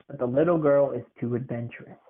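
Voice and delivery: plain reading voice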